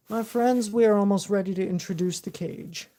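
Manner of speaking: loud showman host voice; announcer voice. Acted. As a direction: announce it to the crowd. Addresses viewers like host.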